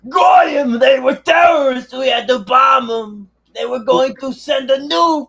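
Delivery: high, slow, loud mocking shout of a caricatured official; shouted mocking caricature. Mocking the officials who bombed civilians, shouting high and slow. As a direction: bellow the excuse in a put-on caricature voice.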